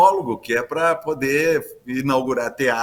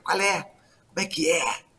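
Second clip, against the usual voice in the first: imitating youngsters, higher voice; garotada cobrando; 4 semitones higher, slower than usual. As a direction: lightly mimic kids asking, pitched up. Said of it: "os garotos, as garotas às vezes falam assim". (imitando a garotada)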